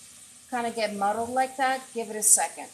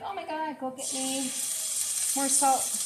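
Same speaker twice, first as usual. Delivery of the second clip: higher, hammed-up panicky cry for salt; mock panic; 5 semitones higher than usual. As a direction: play a cook who thinks the dish is ruined, half joking. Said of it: "Most people would panic and they're like".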